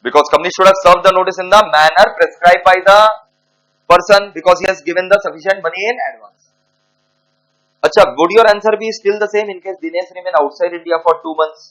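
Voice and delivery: dictating word by word to students; slow dictation. Acted. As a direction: spell it out slowly for the class to write down. Dictating the answer.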